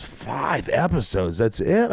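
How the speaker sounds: incredulous dismay. Retold or imitated. Actor to agent on a small episode order, incredulous. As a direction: stretch the number out in disbelief.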